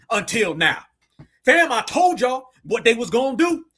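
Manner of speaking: high, loud indignant call-out; fired-up rant. Real, not acted. Streamer calling out a politician to his chat, riled up.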